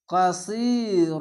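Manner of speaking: কাসির drawn very slowly, stopping on the র; slow recitation. Shown as a demonstration. Teacher reciting the verse ending: slow and final. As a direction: chant কাসির slowly and stop at the verse end. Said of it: "থামতে হবে".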